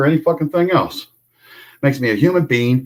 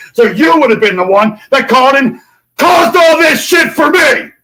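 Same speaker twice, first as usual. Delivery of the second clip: high, loud accusing yell; furious shout; 10 semitones higher, 5 dB louder than usual. Real, not acted. Accusing the governor of calling police, high furious shout. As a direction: throw the blame at him.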